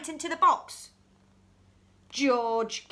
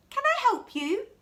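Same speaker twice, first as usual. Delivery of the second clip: much higher, light and eager; girl doctor answering the call, higher voice; higher Sophie voice; 11 semitones higher, 5 dB louder than usual. Acted. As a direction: answer brightly as little girl.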